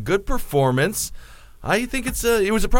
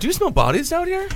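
high mock voice; 13 semitones higher, 3 dB quieter than usual. Mock spooky question.

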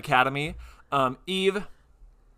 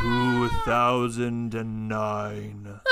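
spooky announcer; 3 semitones lower, 3 dB louder, much slower than usual. Pretend. Spooky slow reading.

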